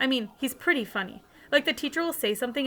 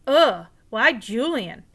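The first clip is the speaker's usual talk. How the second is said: drawn-out disgusted ugh, louder and slow; annoyed groan; 8 dB louder, much slower than usual. A narrator's reading. Boy groaning to his mom about Julian, slow and annoyed. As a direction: groan out your disgust at the name.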